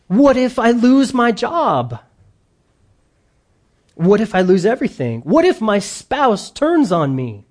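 high, insistent mock worry; acted anxious what-ifs. Voicing believers' anxious what-ifs.

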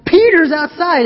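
high, loud breathless news; excited announcement. Retold or imitated. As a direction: burst in with the news. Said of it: "she's so excited".

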